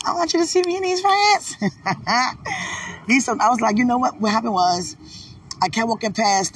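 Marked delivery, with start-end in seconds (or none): 0.1-1.6 very high, giddy delivery; playful excitement. Real, not acted. Showing her sweetheart new pants, high playful excitement. 1.6-3.0 high playful laugh; giggle. Real, not acted. Laughing with her sweetheart over new pants, high giggle.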